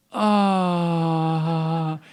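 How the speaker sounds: long drawn-out collective "aww"; audience "aww". Retold or imitated. Whole theater seeing the cute creature, adoring "aww". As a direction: imitate a theater melting. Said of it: "The entire theater goes".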